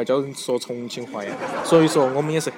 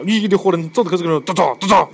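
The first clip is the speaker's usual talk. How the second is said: excited; 6 semitones higher, 8 dB louder than usual. Real, not acted. Teasing reaction to listener comment.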